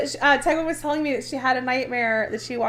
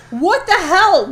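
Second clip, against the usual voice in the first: loud, higher put-on outrage; passive aggressive; 5 semitones higher, 8 dB louder, slower than usual. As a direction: make sure they hear you. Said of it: "I will be like".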